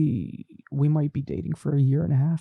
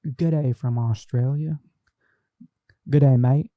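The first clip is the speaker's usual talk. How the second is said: Aussie greeting; 2 semitones higher, 3 dB louder than usual. Retold or imitated. Repeating the Australian greeting.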